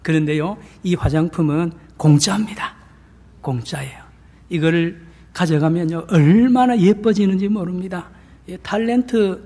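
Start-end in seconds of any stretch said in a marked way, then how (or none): 3.4-4.0 whispered aside to the congregation; secret whisper. Acted. Free cosmetics secret aside. (비밀처럼 속삭이며)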